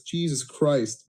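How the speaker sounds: ordinary delivery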